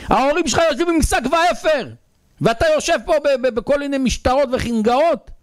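very high, sustained cry of reproach; impassioned rebuke. Retold or imitated. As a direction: cry it out as a prophet scolding a boy. Acted prophet rebukes the boy. (תוכחה נרגשת בזעקה גבוהה)

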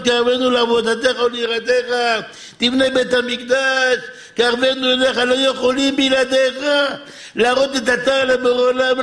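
high, urgent exhortation; impassioned preaching. Real, not acted. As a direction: cry out a plea to heaven before the congregation. Preaching at full force.

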